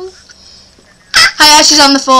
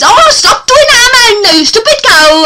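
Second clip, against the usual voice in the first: put-on Mardy accent; 10 semitones higher, 5 dB louder than usual. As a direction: mimic a whining Mardy local, pitched way up. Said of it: "'cause they go like this".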